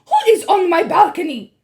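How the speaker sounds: shrill menacing witch; loud, high Grand High Witch snarl. A narrator's reading. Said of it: "she muttered".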